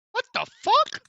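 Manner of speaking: high, loud startled cry into a laugh; shocked outburst. Real, not acted. Startled by a sudden game scare, yelping then laughing. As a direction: jump out of your skin then laugh.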